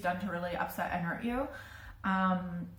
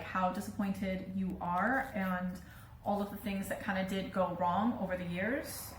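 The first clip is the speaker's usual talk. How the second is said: ordinary delivery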